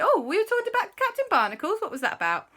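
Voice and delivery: high, fast, loud playing dumb; high mock innocent. Narrator fishing for gossip from the kids, high and fast. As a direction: act sweetly innocent, fast. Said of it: "And I said".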